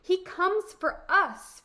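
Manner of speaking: high, loud, slow emphasis; eager storybook boy. Acted. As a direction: press the point like an earnest boy. Boy urges Befana to come along.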